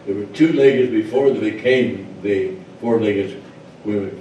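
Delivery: usual speaking voice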